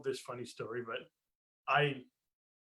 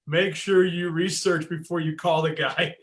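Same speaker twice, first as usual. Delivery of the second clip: mock all caps; 2 semitones higher, 7 dB louder than usual. In capital letters.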